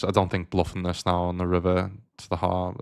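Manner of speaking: normal talking voice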